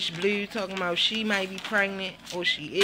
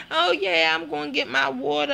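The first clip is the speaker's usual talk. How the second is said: high, sing-song mock of Duchess; 7 semitones higher, 4 dB louder than usual. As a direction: toss it off with a higher put-on voice. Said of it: "So Duchess was like".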